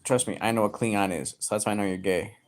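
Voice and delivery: ordinary delivery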